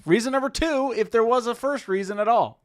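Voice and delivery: high, loud proclamation; announcing loudly. Acted. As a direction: announce it to the room. Mock announcing reasons.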